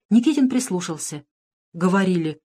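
plain reading voice

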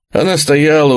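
usual speaking voice

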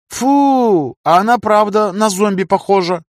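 put-on high childish girl voice; little girl voice. A narrator's reading. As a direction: squeal it like a small girl. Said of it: "комментировала Люся".

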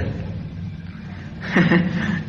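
soft airy chuckle at a silly band name; quiet giggle at a joke; breathy laugh. Acted. Joking about band names.

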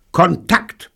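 high, loud pilot's call; bellowed. A narrator's reading. Pilot calls out from the cockpit before take-off, bellowing. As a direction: bellow the start-up command. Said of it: "brüllte er".

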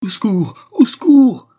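high, loud scream, building in pitch and force; desperate cry for help. A narrator's reading. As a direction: scream frantically for rescue. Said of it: "dit Jeanne éperdue". (appel au secours éperdu)

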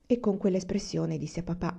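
ordinary delivery, nothing marked

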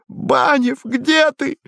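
very high-pitched despairing cry; high, plaintive desperate call; desperate wail. A narrator's reading. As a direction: wail for a lost companion in panic. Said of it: "в отчаянии вопил Квадриго".

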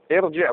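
ارجع opening on "i": irji'; kasra on hamza. Shown as a demonstration. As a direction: start ارجع with "i". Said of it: "حركه الهمزه الوسط تتبع". (ارجع بكسر الهمزة)

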